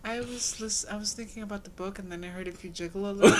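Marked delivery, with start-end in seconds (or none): 2.5-3.4 laughing repeat. Real, not acted.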